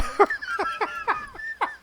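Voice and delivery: helpless laughing at the punchline; high burst of laughter. Real, not acted. Reacts to mom's punchline.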